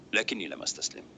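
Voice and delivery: usual speaking voice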